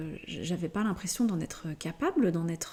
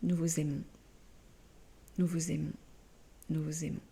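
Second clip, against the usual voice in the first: message canalisé; 3 dB quieter than usual. Acted. Fin d'un message canalisé.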